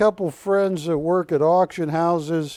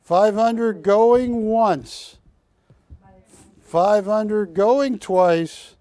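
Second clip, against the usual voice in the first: slow auction call; slower than usual. Real, not acted. Auctioneer calling the lot.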